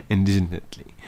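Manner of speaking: "Indignantly" said through a laugh; laughing at herself. Real, not acted. Reader amused by her own stumble, laughing.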